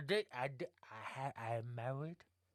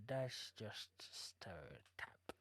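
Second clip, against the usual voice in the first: whispering; 6 semitones lower, 14 dB quieter, slower than usual. Acted. NPC whispers aside.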